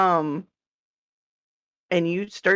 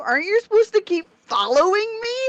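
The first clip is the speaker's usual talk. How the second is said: high mock whine; 11 semitones higher than usual. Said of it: "And it's like".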